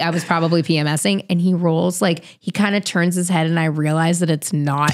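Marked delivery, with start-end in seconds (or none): none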